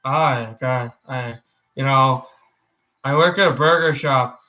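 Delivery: low cartoon character impression; Bob's Burgers. Streamer imitating a burger shop worker, low character voice. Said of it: "That's my Bob's Burgers".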